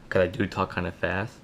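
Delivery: ordinary talk, nothing marked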